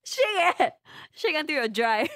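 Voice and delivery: laughing through the words. Real, not acted. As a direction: giggle while saying it. Laughs while reading response.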